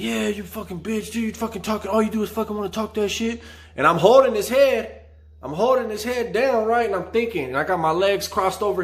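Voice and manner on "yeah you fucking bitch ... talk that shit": high-pitched taunting; voicing the cellmate's insults, much higher; mocking the trash talk, pitched well up. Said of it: "he's like".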